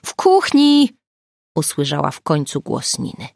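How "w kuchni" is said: high, loud shout from another room; calling back. Acted. Nina answering Agata from the kitchen, loud call. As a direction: call out so she hears you.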